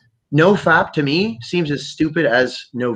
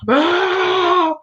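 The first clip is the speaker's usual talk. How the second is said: mock battle yell. Everybody charging in imagined fight.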